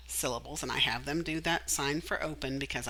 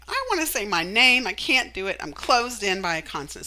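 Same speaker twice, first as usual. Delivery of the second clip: vowel complaining, voiced a bit higher and louder; mad vowel; 5 semitones higher, 5 dB louder than usual. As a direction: act out the vowel sulking at the consonant. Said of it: "And he's like".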